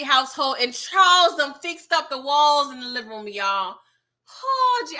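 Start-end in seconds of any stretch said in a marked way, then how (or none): 4.4-5.0 high-pitched exclamation of disbelief; shocked. Real, not acted. Vlogger telling viewers of the patched-up walls, shocked. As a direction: let the shock jump up high, then fall. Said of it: "I almost fainted".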